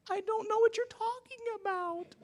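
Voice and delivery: put-on high squeaky little girl voice; daughter's voice.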